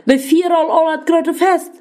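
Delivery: enraged father berating his disobedient son; angry yelling. A narrator's reading. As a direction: rant at the son in rising anger. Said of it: "Der brellt".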